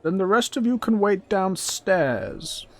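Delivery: high, loud, drawn-out goblin voice; bossy goblin. Acted. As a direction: send them off as a bossy goblin. Goblin orders party downstairs.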